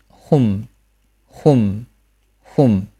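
"hum" with an oo vowel closing to m; isolated careful "hum", humming finish; final m demonstration. Shown as a demonstration. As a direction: round into oo, then seal the lips on "hum". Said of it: "第四个，户加收音".

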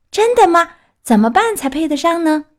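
put-on high, loud, thrilled puppet boy; excited Pinocchio. Puppet hears he may become human, excited. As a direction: burst out with eager questions.

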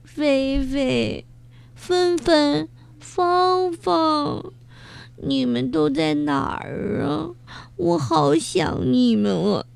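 high, tearful calling of friends' names; crying and calling. Acted. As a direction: call out to lost friends through tears. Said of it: "一边哭， 一边叫着朋友们的名字".

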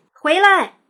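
high, loud urgent call; loud shout. A narrator's reading. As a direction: call him back from afar. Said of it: "大喊一声".

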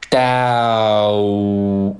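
"dow" hugely stretched on the vowel; overdone teacher demo of "dow"; exaggerated. Shown as a demonstration. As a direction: hold "dow" out in one long glide. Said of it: "我读比较夸张哈".

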